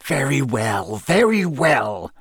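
irritated, drawn out and rising on the repeat; snapped. A narrator's reading. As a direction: give in with mounting annoyance. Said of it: "Snape snapped".

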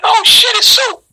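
thrilled high exclamation; excited recognition. Retold or imitated. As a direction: shout like a fan spotting a star. Crowd recognizing him.

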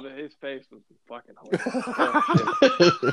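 cracking up remembering his face; laughing. Real, not acted. Telling friends about Adam's face, laughing.

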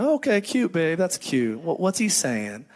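humouring parent. Retold or imitated. Father humours his little daughter in bed, mildly indulgent. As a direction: humour a small child, lightly.

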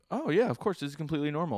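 high airy mock-breezy voice, as if nothing is odd; high-pitched, brushing it off as totally normal; mock casual mom. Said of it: "is kind of like".

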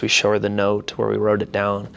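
ordinary delivery

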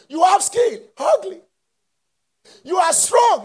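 high, loud preaching cry; preaching shout. Acted. Preacher shouting the list at the congregation.

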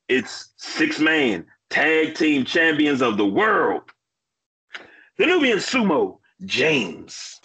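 booming ring announcer intro; ringmaster. Acted. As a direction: announce like a wrestling MC. Podcast intro announcing wrestlers.

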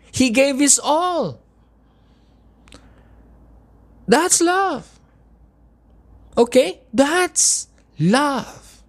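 high, impassioned, pleading questions; emotional full-force preaching. Real, not acted. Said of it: "I get a little bit, uh, emotional".

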